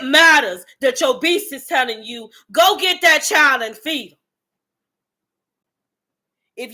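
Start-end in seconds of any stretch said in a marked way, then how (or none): 2.5-4.2 loud, driving command from inside; urgent inner command. Retold or imitated. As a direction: push it like an inner beast demanding action. Inner beast urging to act.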